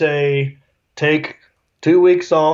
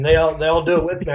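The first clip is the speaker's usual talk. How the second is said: normal talking voice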